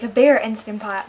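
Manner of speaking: ordinary delivery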